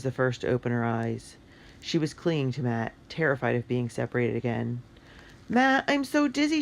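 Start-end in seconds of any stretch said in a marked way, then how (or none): 5.5-6.6 high, loud distressed cry; a dazed girl crying out in alarm; crying out dizzy. Acted. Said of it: "she cried".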